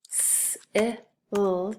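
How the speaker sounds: S-i-l with a long s, short i, held l; S-i-l, sound by sound. Shown as a demonstration. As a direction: sound out S-i-l slowly. Teacher sounds out word for spelling.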